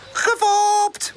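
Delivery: high, gleeful gotcha cry; high-pitched mischievous yell; triumphant shout. A narrator's reading. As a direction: call out that it was a prank. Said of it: "roept hij".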